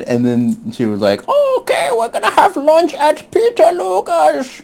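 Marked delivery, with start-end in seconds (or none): 1.3-4.6 put-on very high, squealed English accent; hammed-up English accent. Imitating English friend announcing lunch plans, absurdly high English accent. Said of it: "let me do the accent".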